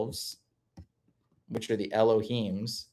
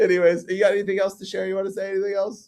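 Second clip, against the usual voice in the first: laughing; 9 semitones higher, 4 dB louder, faster than usual. Real, not acted. Laughing after banter.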